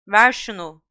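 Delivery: loud call at normal pitch; Harry calling to Malfoy mid-air. Acted. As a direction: shout it across the air. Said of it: "diye seslendi Harry".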